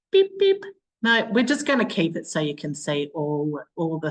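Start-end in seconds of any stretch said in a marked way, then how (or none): 0.1-0.6 high, loud beep sounds; playful beeping. Real, not acted. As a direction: beep like a little machine. Playful noise while screen sharing.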